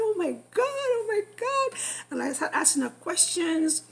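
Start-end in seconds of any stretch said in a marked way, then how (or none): 0.1-1.8 high, gushing oh my God; giddy excited gushing; excited squeals. Retold or imitated. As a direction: squeal it over and over like big news. Retells going on excitedly.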